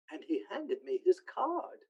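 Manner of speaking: ordinary talk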